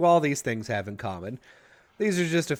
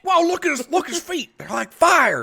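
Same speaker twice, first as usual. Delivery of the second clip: much higher, breathless fake wonder; mock awe; 5 dB louder than usual. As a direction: marvel at the dancer's feet, hamming it up. Continuing mock onlooker bit.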